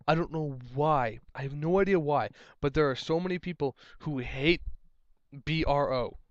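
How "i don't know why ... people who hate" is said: ordinary delivery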